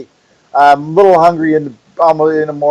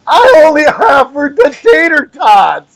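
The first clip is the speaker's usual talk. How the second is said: mock outrage, pitched up; exasperated; 8 semitones higher than usual. Real, not acted. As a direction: vent the absurdity. Exasperated at wait.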